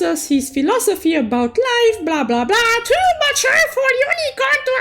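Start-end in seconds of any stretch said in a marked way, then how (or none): none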